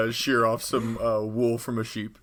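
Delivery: normal talking voice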